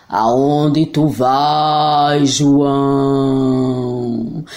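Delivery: voz grave e muito lenta de monstro; friendly monster voice. Acted. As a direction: monstro calmo, arrastado. Monstro pergunta. (voz de monstro)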